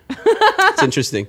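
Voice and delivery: high laughing words. Real, not acted. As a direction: squeal it through laughter. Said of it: "when you go into raptor mode".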